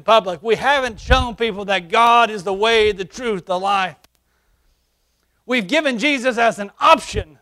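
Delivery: high, loud preacher's cry; full-force preaching. Acted. Pastor preaching to the church, ringing out.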